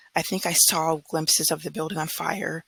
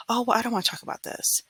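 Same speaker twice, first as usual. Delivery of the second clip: mocking dismissive voice; 6 semitones higher than usual. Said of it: "Don't just say".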